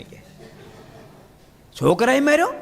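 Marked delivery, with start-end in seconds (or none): 1.8-2.5 high astonished rise on the question; shocked question. Retold or imitated. Swami asks the weeping devotee, shocked and high. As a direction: sound truly taken aback.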